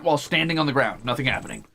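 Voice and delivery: ordinary delivery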